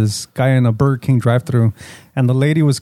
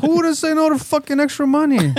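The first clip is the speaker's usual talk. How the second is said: high mocking voice; 12 semitones higher than usual. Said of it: "He was like".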